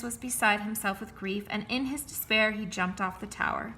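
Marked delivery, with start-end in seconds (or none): none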